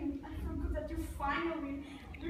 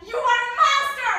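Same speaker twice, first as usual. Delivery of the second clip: much higher, very loud cry; screamed accusation; 6 semitones higher, 16 dB louder than usual. Acted. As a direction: hurl the word at him. Mother shouts at husband.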